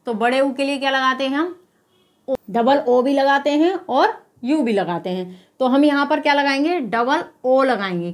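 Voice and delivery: usual speaking voice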